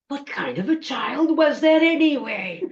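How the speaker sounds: high, whining creature complaint; aggrieved witch wail, half moaning; groaning troll witch. Acted. Troll witch with upset stomach, high groaning complaint. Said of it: "groaning and moving away".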